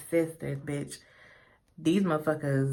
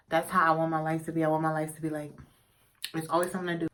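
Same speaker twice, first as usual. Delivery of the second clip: ordinary talk, nothing marked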